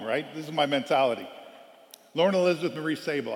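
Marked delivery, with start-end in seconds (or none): none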